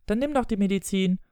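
put-on high-pitched boy's dare; challenging child. Boy challenges his father to take medicine, high child voice.

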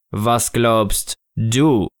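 very slow, weighty figure voice; Snow Father figure. Acted. Snow Father answering a doubting child, very slow solemn voice. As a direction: draw the question out solemnly.

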